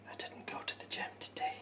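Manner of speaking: high breathy whisper; whispered confession. Acted. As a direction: whisper it like a guilty secret.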